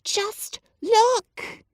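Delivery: put-on high, eager hushed boy; excited whisper. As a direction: urge them breathlessly. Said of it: "he whispered excitedly".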